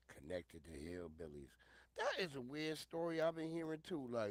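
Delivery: ordinary delivery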